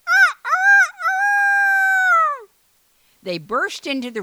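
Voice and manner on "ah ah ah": very high loud crow; rooster crowing. A narrator's reading. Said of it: "And the rooster".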